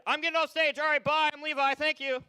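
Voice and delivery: loud, high farewell; loud sign-off. Real, not acted. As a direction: call it out over the room.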